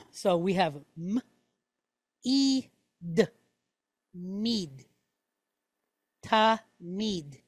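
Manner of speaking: "m", "e", "d", then "mid", then "ta, mid"; sounding out word. Shown as a demonstration. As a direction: build the word tamid sound by sound. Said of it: "Okay, tamid".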